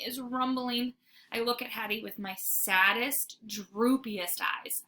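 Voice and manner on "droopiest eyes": ordinary delivery, nothing marked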